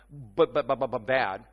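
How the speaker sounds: loud, repeated "But, but, but" in a quick string; stammered objection. Acted. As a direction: stutter out "But" as a mock caveat. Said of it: "This isn't that kind of but caveat".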